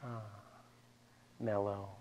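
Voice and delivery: soft, relaxed demo; easy, hushed and mellow. Shown as a demonstration. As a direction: sigh into it, softly. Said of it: "I want you to be, ah, mellow".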